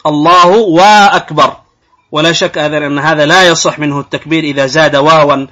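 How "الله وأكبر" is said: "الله وأكبر" slowly, with a drawn-out "waa" between the words: "Allahu waa-akbar"; added waw. Shown as a demonstration. Teacher demonstrating an invalid takbir, extra "wa" in "الله وأكبر". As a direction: insert a clear w sound before "أكبر" in "الله وأكبر". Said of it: "قد يزيد واوا بين الله والتكبير".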